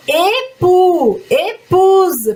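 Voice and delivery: ÉPOUX and ÉPOUSE with a tight closed "ay" at the start; crisp closed E opening Époux and épouse. Shown as a demonstration. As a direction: close the first E in "Époux, épouse", drawn out and emphatic. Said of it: "agora fechou".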